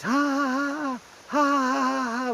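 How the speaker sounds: high, giggly mock laughter; mimicking college kids laughing high; mocking giggles. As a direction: imitate the kids cackling at night. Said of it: "all I can hear is".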